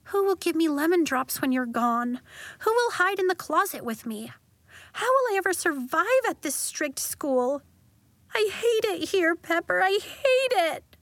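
put-on girl sobbing to her friend she hates the school; weepy, building. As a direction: let the tears build until it breaks. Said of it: "Nori went weepy".

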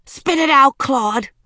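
loud, high-pitched command; urgent order. A narrator's reading. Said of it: "and ordered".

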